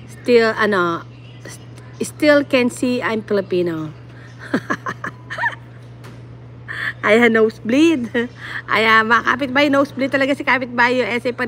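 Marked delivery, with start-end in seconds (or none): 4.4-5.5 short high giggle; laughing. Real, not acted. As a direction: giggle softly in quick bursts. Laughs at own English effort.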